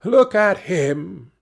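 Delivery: high, drawn-out mocking exclamation; mocking amusement. A narrator's reading. Princess ridiculing the good king, mocking. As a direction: make fun of him, slowly and pointedly. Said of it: "she laughed more than all".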